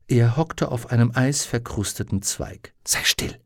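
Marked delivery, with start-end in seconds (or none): 2.8-3.4 whispering; whispered hush. Acted. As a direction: hiss it under the breath. Susanne hushes the raven.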